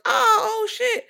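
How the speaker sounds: squeaky high startled cry; startled demons. Retold or imitated. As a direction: play a demon caught off guard, voice jumping way up. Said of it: "And demons like".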